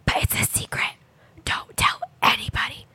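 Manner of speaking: imitating a child's stage whisper; mock kid whisper, conspiratorial; daughter's whisper. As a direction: whisper it the way a little girl shares a secret. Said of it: "She goes".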